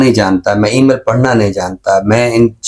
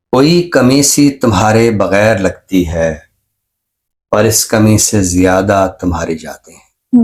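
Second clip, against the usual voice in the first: ghazal recitation. Acted. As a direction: savour each line of the couplet. Recites his ghazal on air. (غزل کا ٹھہرا ہوا ترنم سے پاک پڑھنا)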